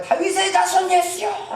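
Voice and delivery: high, drawn-out desperate call; slow, high-pitched imploring cry. Acted. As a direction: cry out to someone passing by. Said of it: "라고 막 부르짖기 시작합니다".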